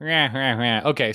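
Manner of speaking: ordinary talk, nothing marked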